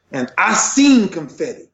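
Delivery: emotional testimony, punched out loud; emotional, impassioned. Real, not acted. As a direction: declare it forcefully, deeply moved. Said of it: "I'm getting emotional now".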